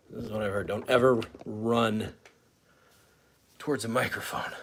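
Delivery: normal talking voice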